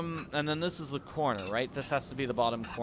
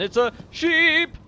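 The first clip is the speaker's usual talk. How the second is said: high, loud burst of surprise; startled exclamation; 9 dB louder, slower than usual. Real, not acted. Player suddenly spots a sheep in the game, startled exclamation. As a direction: blurt it out, pitch leaping up.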